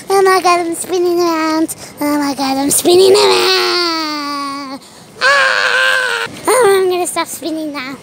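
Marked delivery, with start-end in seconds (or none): 2.6-4.8 slow, drawn-out wail on the last word; mock dizzy wail. Spinning out of control, stretched cry. 5.2-6.3 high, sustained mock scream; play scream. Screams while spinning.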